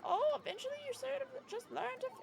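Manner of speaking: put-on high, quavery old lady. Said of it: "I tried to do an old lady voice".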